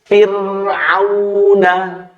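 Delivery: "Fir'auna" drawn out slowly with a thin ro; slow recitation, light ro; Quranic recitation. Shown as a demonstration. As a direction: chant "Fir'auna" slowly, tajwid style. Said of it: "Maka ro sukun ini dibaca tipis".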